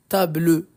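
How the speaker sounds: TABLE with an audible "leu" ending; final E sounded. Shown as a demonstration. Teacher showing students the mistake, "table" with final E sounded. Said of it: "On dit pas table, le".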